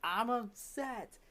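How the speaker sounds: acted-out student admitting it slowly, high-pitched; drawn-out, high "I'm upset"; reluctant upset admission. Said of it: "tell the class you're upset".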